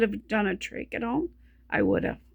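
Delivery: words fading to a breathless whisper; running out of breath. Real, not acted. Describing a home trach, she runs out of breath. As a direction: voice runs out of air mid-sentence. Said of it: "right back there when I said trach".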